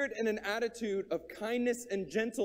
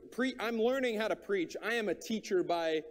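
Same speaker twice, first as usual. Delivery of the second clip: ordinary talk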